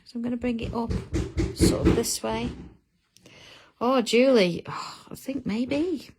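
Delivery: ordinary talk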